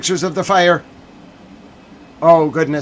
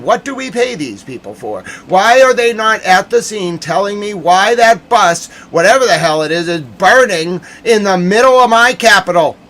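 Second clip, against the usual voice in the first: raised, indignant voice building in force; exasperated rant; 3 semitones higher, 4 dB louder than usual. Real, not acted. Journalist berating press who aren't at the scene, exasperated. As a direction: demand it of the missing reporters.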